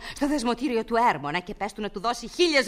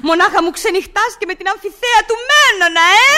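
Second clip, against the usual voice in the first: accusing; 5 semitones higher, 6 dB louder than usual. Acted. Jealous wife accuses husband.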